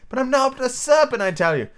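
high, insistent denial; protesting Alice. Acted. Alice denying the pigeon's accusation, high and insistent.

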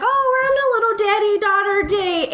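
high, gushing retold delight; happy. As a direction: gush it in a high, cutesy voice. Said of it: "I was so happy".

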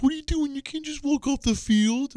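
high, mock-scolding wife's voice; Kim scolding. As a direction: imitate the wife's exasperated scolding. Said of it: "my wife, Kim, is like".

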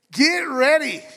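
high, loud mock-ominous "get ready"; playful warning. Pastor teasing the congregation about the money series, mock-ominous and loud. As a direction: tease the church about money talk.

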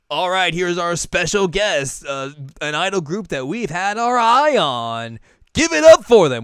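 high, loud emcee introduction; hammed-up live show host; stage announcer. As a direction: build to a big shouted crowd cue. Acts stage host introducing act.

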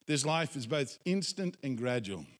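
ordinary delivery

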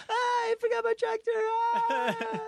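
very high, hammed-up cartoon wail; mock scream. Goku flying over his forgotten tractor, high mock scream. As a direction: cry it out like a hero hurtling past.

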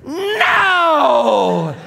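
high, loud drawn-out wail; hammed-up howl of refusal; crowd's shouted protest. Democrats reacting to Paul's provocation, long mock-outraged shout. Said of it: "all the Democrats went".

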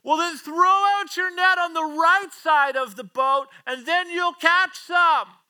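high, slow, loud holler from shore; yelling out. Acted. Jesus advising fishermen from the shore, yelling out. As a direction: call each word across a long distance. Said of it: "yelling out to them".

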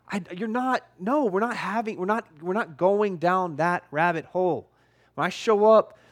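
ordinary talk

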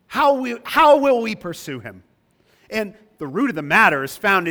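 high, loud indignant question; voicing the friends. Preacher quoting Job's friends loudly. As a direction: challenge it like an accuser. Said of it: "If you say".

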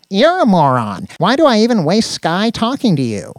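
high, loud fed-up nerd; exasperated Danny. Acted. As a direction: snap back, rising in exasperation. Danny returns insult in quarrel.

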